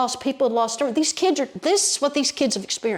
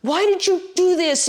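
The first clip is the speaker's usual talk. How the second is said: high-pitched, anguished demand, drawn out; angry cry to God; 8 semitones higher, slower than usual. Retold or imitated. Storyteller voices angry boy questioning God after the flood, angry cry. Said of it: "He was angry".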